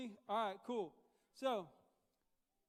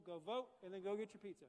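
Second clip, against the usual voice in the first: ordinary talk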